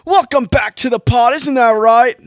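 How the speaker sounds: high, loud party-bro voice; frat boy voice. Acted. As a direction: hype it up like a bro partying in a basement. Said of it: "you sound like a surfer at the end".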